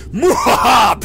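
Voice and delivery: hammy villain cackle; evil laugh. Acted. Final Boss villain intro laugh.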